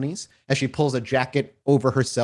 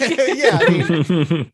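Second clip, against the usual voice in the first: laughing; 6 semitones higher, 7 dB louder, much slower than usual. Real, not acted. GM laughing at joke.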